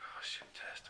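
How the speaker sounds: whispered dread under the breath. Retold or imitated. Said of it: "I was like".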